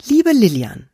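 mother reading a note aloud to her daughter. A narrator's reading. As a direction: read the note out clearly. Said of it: "las sie laut".

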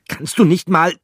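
high, loud, fed-up little animal voice; character voice, cut off mid-complaint; exasperated Pikandou. Acted. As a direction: snap at him in irritation. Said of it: "begann Pikandou".

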